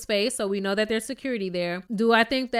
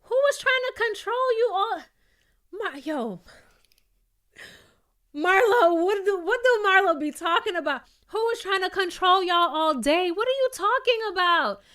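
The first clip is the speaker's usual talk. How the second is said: incredulous disbelief; 9 semitones higher than usual. Real, not acted. Outraged at Marlo's claim.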